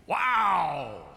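a hammed-up tourist wow; mock awed wow. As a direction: gawk at the falls. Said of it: "really eloquent things like".

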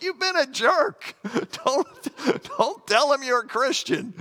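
hammed-up scolding of the rude driver, through laughs; mock exasperated yell. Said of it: "I'm like".

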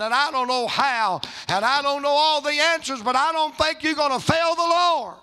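high, loud, climbing to a shouted peak; full-force preaching. Retold or imitated. Pastor assures grieving wife of her faith, building fervent preaching.

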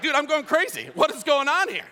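high-pitched hammed-up alarm; mock bewilderment. Acting bewilderment at talking chicken.

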